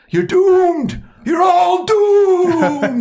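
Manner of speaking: high, slow, ominous drawl; Crazy Ralph doom warning. Retold or imitated. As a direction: wail the doom like a crazed old prophet. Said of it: "that's Crazy Ralph from Friday One and Two".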